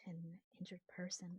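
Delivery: whispering. Acted. Whispers in the temple.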